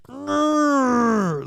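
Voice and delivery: high, playful little growl; mock growl. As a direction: growl like an annoyed cartoon. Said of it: "I'm just like".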